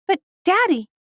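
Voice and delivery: put-on high, pleading child voice; a small daughter whining at her dad; child voice, protesting; little girl's protest. A narrator's reading. Little girl protests to father.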